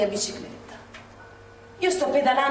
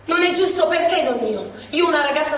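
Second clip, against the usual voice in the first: higher and louder, pained protest; anguished outcry; 6 semitones higher, 4 dB louder than usual. Acted. Woman grieving that her friend was hit instead, anguished outcry. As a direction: cry out at the injustice.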